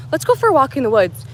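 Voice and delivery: ordinary talk, nothing marked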